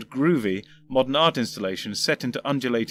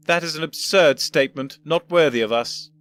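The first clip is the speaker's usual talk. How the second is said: put-on louder, reproving elderly voice; disappointed old gentleman; 4 semitones higher, 6 dB louder than usual. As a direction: scold like a let-down grandfather. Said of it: "shook his head in disappointment".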